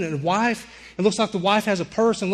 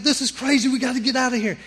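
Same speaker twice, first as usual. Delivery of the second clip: higher frightened yelling; panicked scream; 7 semitones higher, faster than usual. Retold or imitated. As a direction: plead in terror to leave. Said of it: "I'm screaming again".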